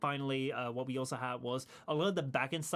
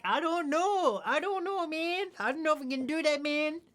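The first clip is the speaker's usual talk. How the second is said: much higher, put-on squeal of protest; mock flustered protest; 11 semitones higher, 4 dB louder than usual. Improv performer asked for accents, mock flustered high voice. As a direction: plead it in a high, strained mock voice.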